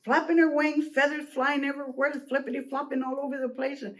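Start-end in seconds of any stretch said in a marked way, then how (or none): none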